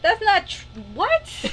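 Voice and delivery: high, rising shocked "what"; surprised protest. Real, not acted. A girl hears a silly claim about her family's state, surprised. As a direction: react in disbelief.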